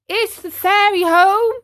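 high, loud eager question; marionette calling up. Acted. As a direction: call up to the window as a puppet. Marionette calls up to window.

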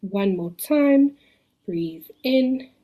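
slow, gentle meditation cue. Acted. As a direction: ease them into another breath.

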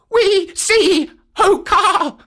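very high, loud wailing moan; moaning monkeys. Acted. Spellbound monkeys answering the python, high loud moan. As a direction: wail it like wind through treetops. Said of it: "came a moan, like the wind in the treetops".

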